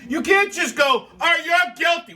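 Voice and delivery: high, loud mock verdict; indignant shout. Ranting, mimics an instant verdict.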